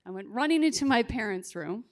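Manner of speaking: usual speaking voice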